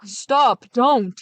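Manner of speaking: loud panicked cry to stop; a trapped man begging not to be thrown in; shouted plea. Acted. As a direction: yell it from inside the chest. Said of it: "the sexton shouted inside".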